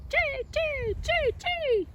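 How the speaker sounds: very high, sharp jay calls; high piercing jay cry; blue jay shriek. Acted. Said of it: "The blue jay's shriek is as sharp".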